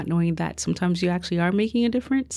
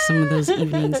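imitating mysterious. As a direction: echo the phrase with a teasing mysterious rise. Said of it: "You make it sound all mysterious".

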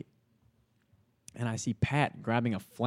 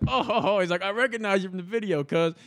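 higher and louder, laughing as he recognizes him; amused recognition; 7 semitones higher, 6 dB louder than usual. Retold or imitated. Pat recognizing him from the video, amused and laughing. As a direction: greet him with a delighted laugh.

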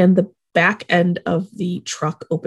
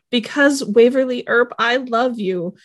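usual speaking voice

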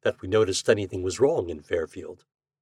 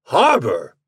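landlord's louder, higher exclamation; incredulous countryman calling out; startled cry; 4 semitones higher, 6 dB louder than usual. A narrator's reading. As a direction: cry it out as a baffled question. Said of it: "cried landlord".

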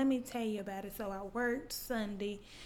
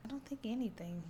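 whisper; 8 dB quieter than usual. Real, not acted. Unvoiced aside.

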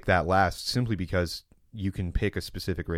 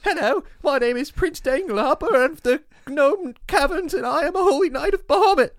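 very high, loud squeaky knight; pompous little gnome hero, pitched way up; tiny gnome paladin voice; 7 dB louder than usual. Acted. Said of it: "he talks like this the entire time".

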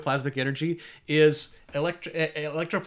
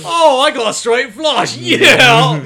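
excited shout; 11 semitones higher, 15 dB louder than usual. Pretend. Acting out a poker win.

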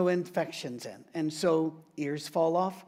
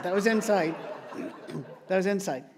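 chuckling admission; sheepish chuckle; laughing aside. Real, not acted. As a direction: laugh through "That was inside". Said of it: "No, I didn't say that".

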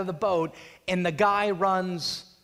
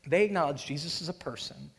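normal talking voice

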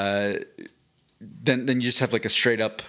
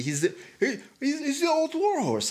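put-on a much higher, strained try at a New Zealand accent with a laugh; bad New Zealand accent; 14 semitones higher than usual. Host trying a New Zealand accent for a fighter, badly. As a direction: botch a Kiwi accent, squeaking it out much higher. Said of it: "I can't do that, that accent".

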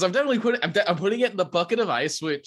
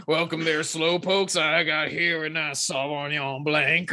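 bad, slow old-timey cowboy drawl; a hammed-up Western saloon host; old-timey Westerner voice; slower than usual. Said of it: "in her best, ... still very bad, like, old-timey Westerner voice".

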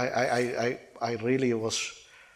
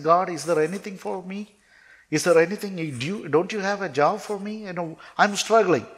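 higher-pitched, earnest appeal; pleading; 6 semitones higher than usual. Retold or imitated. Struggling young man begging God for work, pleading. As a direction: plead upward in a raised pitch. Retold desperate plea to God.

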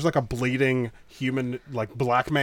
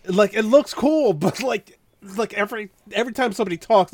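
exasperated rant; 8 semitones higher, 5 dB louder than usual. Real, not acted. Exasperated high ranting.

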